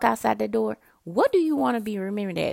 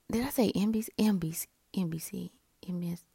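unvoiced whisper, checking herself; whispered self-correction; 8 dB quieter than usual. Real, not acted. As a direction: whisper it to yourself, unsure of the name. Self-correcting under breath.

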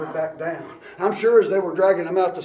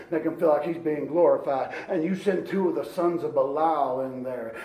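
normal talking voice

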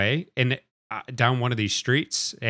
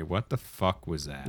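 softer, lower, slow; muted retold cop; 6 semitones lower, 10 dB quieter than usual. Quoting the cop's exact words to friends, much softer and slow. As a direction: mutter the cop's words flatly. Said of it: "his just words to me".

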